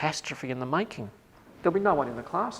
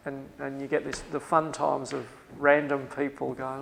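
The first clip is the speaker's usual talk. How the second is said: ordinary talk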